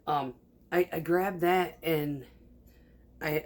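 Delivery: ordinary delivery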